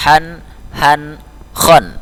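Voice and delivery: "Han, han, khon": sharp, rounded, then throaty; held "Han, han, khon", each h distinct; three contrasting h letters. Shown as a demonstration. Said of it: "dia agak berbunyi tenggorokan".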